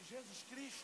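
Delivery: barely audible, high, questioning murmur; timid, hushed call. Retold or imitated. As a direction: read the name out almost under your breath. Barista timidly reads name on cup. (chamando bem baixinho, sem coragem)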